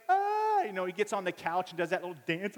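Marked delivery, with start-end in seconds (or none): 0.1-0.6 high, loud mock scream; sudden piercing yelp in imitation; child's scream. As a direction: shriek like a scared five-year-old. Boy screams at the phlebotomist.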